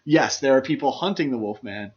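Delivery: usual speaking voice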